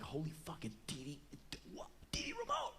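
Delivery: hushed, breath-only disbelief; starstruck whisper. Retold or imitated. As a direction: whisper in awe at your idol up close.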